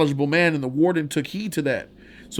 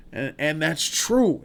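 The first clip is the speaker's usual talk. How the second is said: ordinary talk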